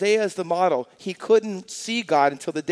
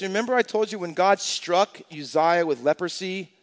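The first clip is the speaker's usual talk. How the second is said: ordinary talk, nothing marked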